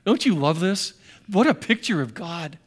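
usual speaking voice

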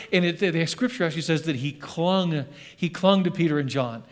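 ordinary talk, nothing marked

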